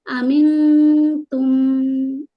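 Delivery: अमिन तुम with the न hidden in the nose, held two beats; drawn-out nasal न in अमिन, slow and chanted; nasal ikhfa demo. Shown as a demonstration. Teacher demonstrating ikhfa to students, slow nasal hold. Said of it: "नाक में छुपाकर है ना".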